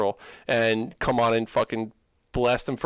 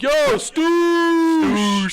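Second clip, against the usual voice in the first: calling a nickname loudly; shout; 7 dB louder than usual. Retold or imitated. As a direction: yell it across the room.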